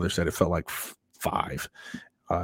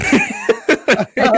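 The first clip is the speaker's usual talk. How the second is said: loud laughter; 8 dB louder than usual. Real, not acted. Laughing at his own story.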